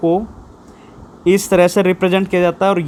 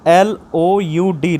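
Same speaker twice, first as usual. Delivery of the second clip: slow, separated letter names; spelling out letters slowly; much slower than usual. Shown as a demonstration. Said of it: "जैसे".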